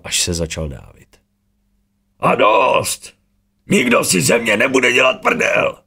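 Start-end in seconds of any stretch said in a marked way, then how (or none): 2.2-5.8 high, loud enraged bellow; furious roar. A narrator's reading. Urn bellows at the mocking villain before crushing the disk, furious roar. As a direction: explode with rage. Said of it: "Zařval Urn".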